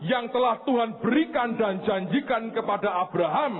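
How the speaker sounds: high-pitched, emphatic preaching. Real, not acted. (berkhotbah dengan nada tinggi)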